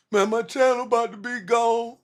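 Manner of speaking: high, whiny imitation of someone crying; mock crying whine. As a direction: mimic a crybaby whining. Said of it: "had them motherfuckers crying".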